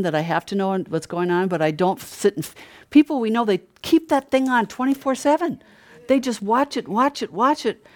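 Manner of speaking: ordinary talk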